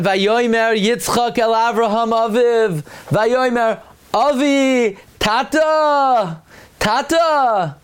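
high, slow pleading call of Tata; a son calling out to his father; high drawn-out plea. Acted. Yitzchak calls his father.

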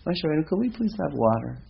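high-pitched pleading politeness; mock polite request. Rabbi voicing how the people should have asked Moshe, politely. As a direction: ask sweetly in a put-on high voice. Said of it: "but we didn't say".

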